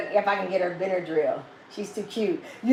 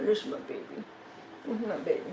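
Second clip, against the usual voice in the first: breathy whisper to a beloved pet; whispered affection; 8 dB quieter, faster than usual. Real, not acted. Owner hugging her dog, whispering lovingly. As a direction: whisper it tenderly.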